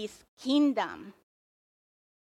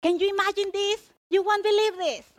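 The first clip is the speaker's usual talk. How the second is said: high-pitched, quick, astonished storyteller; excited attention-grabber; 8 semitones higher, faster than usual. Shown as a demonstration. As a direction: hook the listeners with breathless news. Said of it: "Perlos version would be like".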